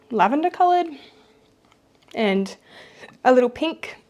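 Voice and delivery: talking through the hiccups; hiccupping between words. Real, not acted. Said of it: "I got the hiccups halfway through this vlog".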